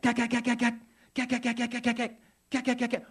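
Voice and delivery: short, controlled imitated gun bursts; high staccato gun noise in measured bursts; machine-gun bursts. Shown as a demonstration. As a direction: rattle off rapid gunfire with pauses between bursts. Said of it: "it sounds sort of like this".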